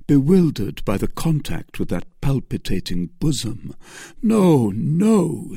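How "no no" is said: impassioned protest. A narrator's reading. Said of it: "said he".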